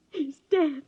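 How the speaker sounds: breaking into tears on the news; crying. Acted. Daughter telling of her father's death, crying. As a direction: voice cracking with a cry, grief spilling over.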